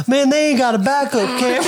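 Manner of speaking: blurted out fast through laughter; laughing quote. Retold or imitated. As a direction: quote it fast while cracking up. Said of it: "the first thing they said was".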